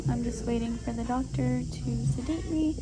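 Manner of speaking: ordinary talk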